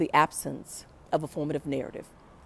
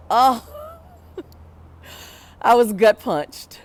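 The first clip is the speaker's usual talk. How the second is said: real emotion, voice cracking with a laugh-cry, slow; gut-punched, half laughing, half crying; choked up; 5 semitones higher, 8 dB louder, slower than usual. Real, not acted. Speaker recalling the lecture's impact, laughing through tears. Moved by the professor's remark.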